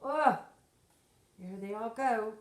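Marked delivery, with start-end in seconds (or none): none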